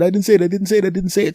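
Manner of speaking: high, quick protesting; frantic denial. Retold or imitated. Man recalls his plea to the lady, frantic. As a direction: replay the panicky denial, high and insistent. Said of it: "Now, I had just said".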